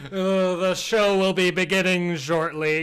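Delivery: booming, slow, drawn-out announcer; fighting game announcer. Acted. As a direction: hype up the crowd, slowly. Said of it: "an announcer voice emanates".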